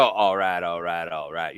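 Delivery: low, soft "all right, all right, all right" in a southern twang. Shown as a demonstration. Low southern twang, showing Jennifer how to say it. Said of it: "that twang into there like that".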